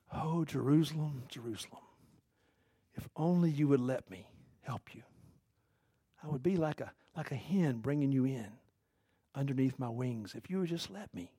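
very soft, sorrowful pleading; heartbroken lament. Retold or imitated. Jesus looking over Jerusalem that rejected him, softly heartbroken. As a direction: lament softly, letting phrases sink away. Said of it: "He's heartbroken".

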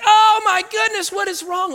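high-pitched mock panic; hammed-up freak-out, starting loud. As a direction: wail it loudly in exaggerated alarm. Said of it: "I'm freaking out".

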